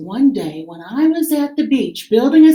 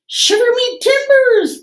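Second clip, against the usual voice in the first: high, louder pirate exclamation; surprised pirate; 13 semitones higher, 5 dB louder than usual. Acted. Pirate amazed to find a beach, high surprised shout. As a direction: shiver me timbers in amazed delight.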